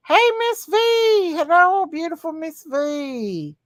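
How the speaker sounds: high-pitched sing-out hello; excited greeting. Real, not acted. Streamer welcoming Miss V to the live, excited greeting. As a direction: call out high and delighted. Greeting a viewer who arrives.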